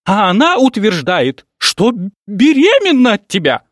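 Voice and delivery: high, loud outraged shriek. Acted. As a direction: shriek the scandal. Mother's outraged accusation.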